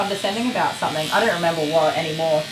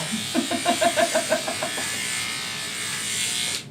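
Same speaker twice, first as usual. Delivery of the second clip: high airy laugh; 11 semitones higher, 5 dB quieter than usual. Real, not acted. Laughing at husband's reply.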